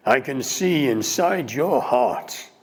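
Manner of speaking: loud, intense pleading voice; passionate heckler. Retold or imitated. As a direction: challenge him to speak from the heart. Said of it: "this voice calls out".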